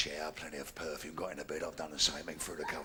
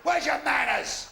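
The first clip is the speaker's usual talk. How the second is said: loud mock-indignant stop; woman cuts him off, hamming it up; indignant shout; 6 semitones higher, 5 dB louder than usual. Woman halting him outside, loud mock-indignant shout.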